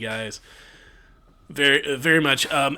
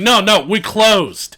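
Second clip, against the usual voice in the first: high, loud, drawn-out refusal; insistent high yell; shop owner turning customers away; 9 semitones higher, 12 dB louder, slower than usual. Acted. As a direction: shout them away, stretching the words. Owner turns the party away.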